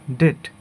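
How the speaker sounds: ডেট with a short pure e; common mispronunciation. Shown as a demonstration. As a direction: say ডেট as "det", no glide. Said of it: "আমরা সাধারণত বলি".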